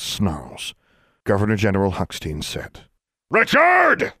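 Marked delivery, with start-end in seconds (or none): 3.3-4.1 loud, high call. Acted. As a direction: summon the aide from the next room. Governor calls his aide.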